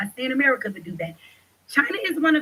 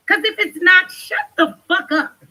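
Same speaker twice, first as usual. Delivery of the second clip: louder and higher, slowing on shut the fuck up; angry at chat; 6 semitones higher, 4 dB louder than usual. Real, not acted. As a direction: snap back at the trolls in the chat.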